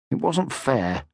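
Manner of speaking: ordinary delivery, nothing marked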